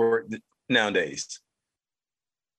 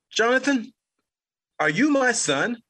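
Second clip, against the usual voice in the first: high, stern mother's voice; mom's stern question; 9 semitones higher than usual. Retold or imitated. Mother demanding on the phone, stern and high. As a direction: voice her pointed question.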